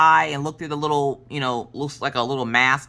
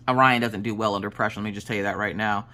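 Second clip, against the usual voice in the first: ordinary talk, nothing marked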